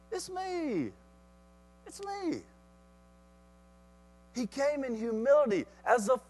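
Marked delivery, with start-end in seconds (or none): 0.1-2.4 soft, high, pleading voice; high and hushed, calming them; gentle reassurance. Acted. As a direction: softly plead to be known by friends. Jesus pleads to be recognized.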